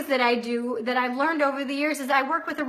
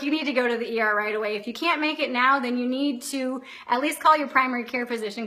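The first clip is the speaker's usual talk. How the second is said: rattled-off advice; much faster than usual. Retold or imitated. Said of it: "He's like".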